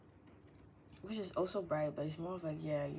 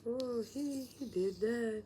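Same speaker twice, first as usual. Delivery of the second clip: amazed exclamation; 5 semitones higher, 4 dB louder, slower than usual. Retold or imitated. Imagined awe at well-dressed man.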